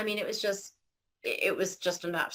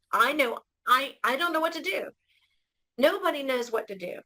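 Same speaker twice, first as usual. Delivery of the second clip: retold desperate prayer, voice lifting higher; desperate plea; 5 semitones higher than usual. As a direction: plead helplessly, pitch climbing.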